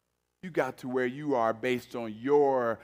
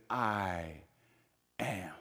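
soft, low, hushed reverence; hushed reverent; 7 semitones lower, 9 dB quieter than usual. Acted. Pastor hushes Jesus's words for the congregation, reverent. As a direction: let the holy name drop to a hush.

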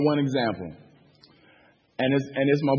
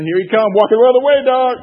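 louder, quicker voice of a wary onlooker; mock warning; 7 semitones higher, 9 dB louder, faster than usual. Townsfolk warning each other about Leon, loud mock warning. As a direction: blurt a loud, hurried heads-up. Voicing wary community members' reaction.

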